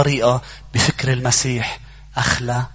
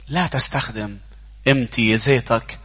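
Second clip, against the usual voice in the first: ordinary delivery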